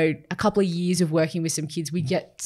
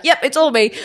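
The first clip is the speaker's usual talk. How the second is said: mock boast; 7 semitones higher, 6 dB louder than usual. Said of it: "I don't expect you to kind of be like".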